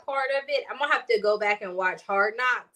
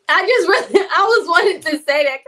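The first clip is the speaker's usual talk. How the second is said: talking through real laughter, higher and louder; laughing; 5 semitones higher, 11 dB louder than usual. Real, not acted. Podcaster cracking up over a coach's sideline squint, laughing. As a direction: laugh so hard the words jump up loud and high.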